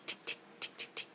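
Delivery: soft, unvoiced tick tick of dripping water; whispered ticking imitation. Host imitating her leaking roof dripping, whispered. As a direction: tick it out under your breath like a leak. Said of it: "I can hear this tick".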